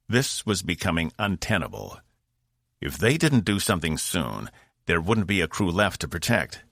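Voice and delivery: usual speaking voice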